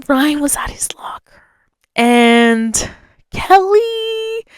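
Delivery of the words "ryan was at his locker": hushed, breathy aside; whisper. Real, not acted. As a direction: drop to a secret whisper. Dramatic storytelling whisper.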